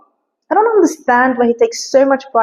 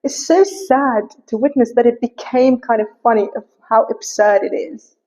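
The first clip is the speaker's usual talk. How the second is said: ordinary delivery, nothing marked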